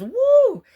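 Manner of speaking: a high, loud whoo of overwhelm. Real, not acted. Reliving the room filling up, a high loud whoo.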